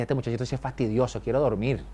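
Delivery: low, soft weary grumble; worn-out, hushed complaint; tired old priest. Acted. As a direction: grumble quietly, wanting to sleep. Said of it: "a lo mejor Elí estaría cansado".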